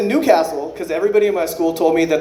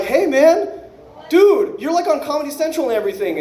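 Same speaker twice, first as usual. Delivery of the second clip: high, eager starstruck gushing; mock starstruck fan; excited fan; 9 semitones higher than usual. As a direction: gush at your hero, pitched up. Excited fan meeting hero.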